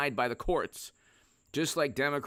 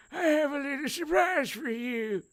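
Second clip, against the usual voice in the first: much higher put-on old senator voice; Patrick Leahy impression; 14 semitones higher than usual. Retelling the Kavanaugh hearings as Leahy, much higher. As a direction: croon it high like a sly old senator. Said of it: "then you had Patrick Leahy".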